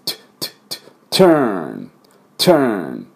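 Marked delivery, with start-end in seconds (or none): 0.0-0.5 トゥ as a quiet burst of air with no voice; puffed t. Shown as a demonstration. Teacher demonstrates the t burst to students, quiet puffs. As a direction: spit out トゥ as a soft pushed puff. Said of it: "このプシュッと音です".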